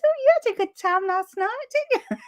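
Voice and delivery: teasing neighbour voice, pitched well up; sing-song knowing tease; nosy neighbour. Retold or imitated. Neighbour teasing her about last night, high and knowing. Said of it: "she goes".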